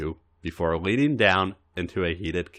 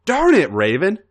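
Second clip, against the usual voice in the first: frustrated outburst; 10 semitones higher, 6 dB louder than usual. Acted. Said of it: "A J exploded in frustration".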